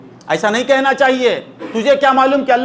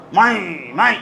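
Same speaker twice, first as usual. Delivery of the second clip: goat bleat; 5 semitones lower, 3 dB quieter than usual. Retold or imitated. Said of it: "وہ بکرا ہو".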